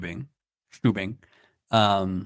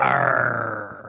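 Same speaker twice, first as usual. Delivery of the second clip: growled pirate arr; 3 semitones lower than usual. Pretend. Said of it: "we are in the Pirates Cove".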